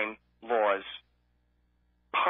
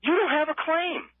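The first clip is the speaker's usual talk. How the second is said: mocking insurer voice; 8 semitones higher than usual. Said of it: "What a way to do business".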